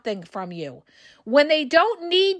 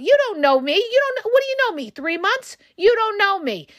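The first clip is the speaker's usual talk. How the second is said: indignant retort; 7 semitones higher than usual. Acted.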